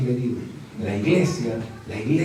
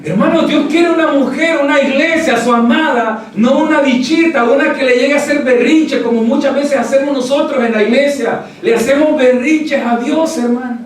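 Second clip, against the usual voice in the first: full-force preaching; 12 semitones higher, 11 dB louder than usual. Acted. Pastor to the congregation, full-force preaching.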